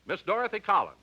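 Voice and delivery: usual speaking voice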